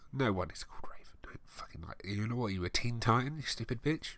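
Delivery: hushed exasperated whisper; whispered rant. Real, not acted. Reader ranting quietly at the fanfic author. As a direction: hiss it under your breath in disbelief.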